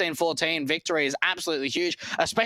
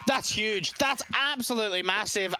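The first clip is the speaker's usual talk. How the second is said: excited; 6 semitones higher, slower than usual. Real, not acted. Co-caster reacts to map win.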